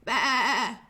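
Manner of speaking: playful mock baa; sheep bleat. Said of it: "that might be a sheep".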